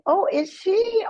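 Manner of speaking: put-on high, slow, wondering old mother; mock elderly mother, high and slow; mother's delighted reply. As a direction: stretch it up high like a pleased elderly mom.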